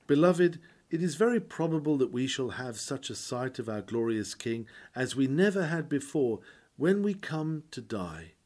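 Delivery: normal talking voice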